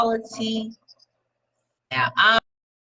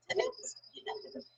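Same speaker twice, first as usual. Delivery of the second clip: breathy whisper, drawn out slowly; slow hushed whisper; 18 dB quieter, much slower than usual. Real, not acted. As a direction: whisper it like a secret. Whispered punchline.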